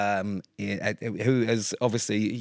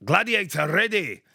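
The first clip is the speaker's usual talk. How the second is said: high, slow, drawn-out game-show ref call; referee announcer call; 9 semitones higher, 3 dB louder, slower than usual. Retold or imitated. Imitating the old Scottish referee starting a Gladiators event, drawn out. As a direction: belt it like the old arena referee. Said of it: "He used to have the old Scottish guy".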